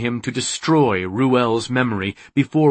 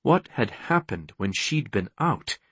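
ordinary delivery

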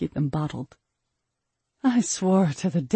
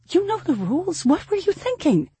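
very high-pitched, upset rebuke; angry scolding; 13 semitones higher, 3 dB louder than usual. A narrator's reading. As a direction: scold the child, pitch flying up in alarm. Frightened mother scolds daughter.

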